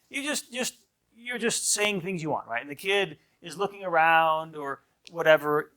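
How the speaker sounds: ordinary talk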